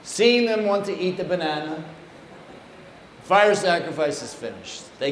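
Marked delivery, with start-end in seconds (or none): none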